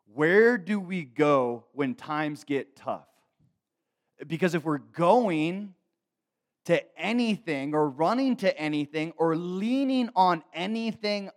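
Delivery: loud, slow, high pulpit challenge; drawn-out, emphatic sermon climax; full-force preaching. Real, not acted. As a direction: press it on the congregation, stretching key words.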